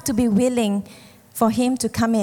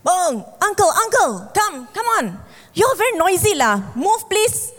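lightly voiced boatman urging uncle on, a bit higher; light higher rescuer's call; 5 semitones higher than usual. Speedboat man calls him.

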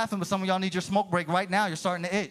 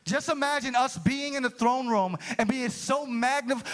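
ordinary delivery

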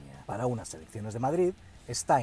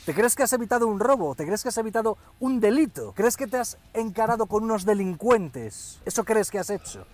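raised, incredulous grilling; mock outrage; 7 semitones higher, 6 dB louder than usual. Scolding the politician over his robbery tale, mock indignant. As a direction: press each question high and loud.

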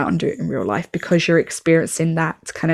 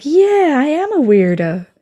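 mock proud; 8 semitones higher, 3 dB louder than usual. Mock self-admission to reader.

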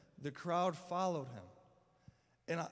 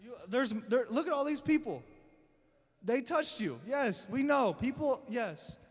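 exasperated father; 5 semitones higher, 5 dB louder than usual. Retold or imitated. Impatient Jairus voiced to Jesus.

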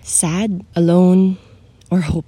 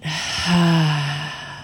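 long open-mouthed exhale; audible sigh; 2 semitones lower, 3 dB quieter than usual. Shown as a demonstration. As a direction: let the breath fall out with a soft ha. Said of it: "and sigh it out".

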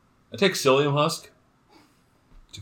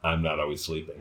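low, hushed confession; leaning in to whisper; 8 semitones lower, 7 dB quieter than usual. Real, not acted. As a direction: drop it much lower and softer, sharing a secret. Confesses a secret to wife.

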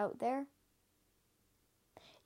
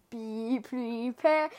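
slow ominous drawl; dramatic villain; 4 dB louder, much slower than usual. Shown as a demonstration. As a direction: stretch it out like a movie villain. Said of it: "the first thing that came into my mind".

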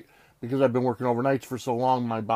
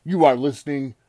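booming ring announcer intro; grand entrance announcement; 3 semitones higher, 5 dB louder than usual. Acted. Wrestling-style podcast intro.

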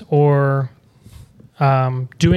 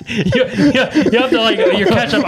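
high laughing plea; 6 semitones higher than usual. Real, not acted. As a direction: plead through laughter. Laughing request to relearn slang.

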